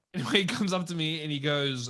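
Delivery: ordinary talk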